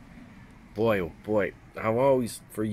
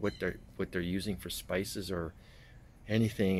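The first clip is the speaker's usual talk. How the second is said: ordinary talk, nothing marked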